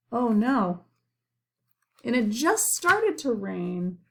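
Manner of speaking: ordinary delivery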